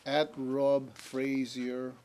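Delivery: very slow, careful read-back; drawn-out and deliberate repeating; over enunciating. Shown as a demonstration. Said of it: "say it one more time slowly".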